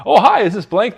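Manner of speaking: high, loud, chirpy sales greeting like a clown; overly enthusiastic salesperson. Shown as a demonstration. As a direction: oversell it like a clown. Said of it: "The classic overly enthusiastic salesperson".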